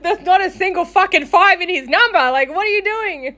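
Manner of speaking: high-pitched mock telling-off; exasperated scolding. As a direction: imitate an incredulous friend scolding you. Friend's exasperated scolding, retold.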